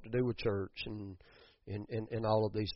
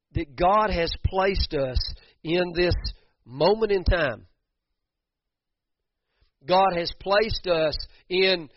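loud, high, slow preacher's call; preaching; 6 semitones higher, 8 dB louder than usual. Acted. Preacher telling Bremen folks God placed them here, loud. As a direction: preach each word slowly and loud.

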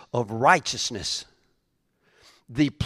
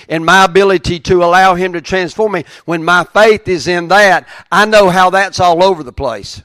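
ordinary delivery

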